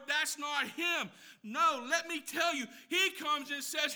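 loud, high preaching at full force; fiery preaching. Acted. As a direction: preach it hard to the congregation.

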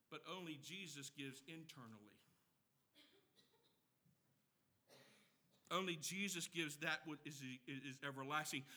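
quiet solemn emphasis; hushed reverence. Real, not acted. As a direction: bring it down to a near-whisper of awe. Hushed contrast to world's giving.

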